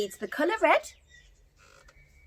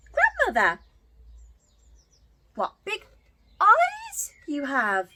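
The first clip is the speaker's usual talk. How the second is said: high, slow wonder on eyes; girl exclaiming; 9 semitones higher, 5 dB louder than usual. Acted. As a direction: marvel like an amazed little girl.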